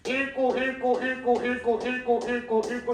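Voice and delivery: mock student reading in a panic; panicked student. Shown as a demonstration. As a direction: act out a rushed test-taker. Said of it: "내가 극단적 예를 보여줄 거야".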